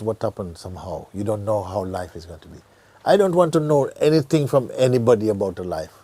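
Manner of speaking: ordinary talk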